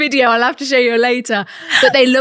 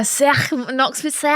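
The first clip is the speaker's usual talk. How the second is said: Scouse catchphrase; 3 dB quieter, slower than usual. Shown as a demonstration. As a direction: model the Scouse SICK with throaty k. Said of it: "Scouse is one of my favourite accents".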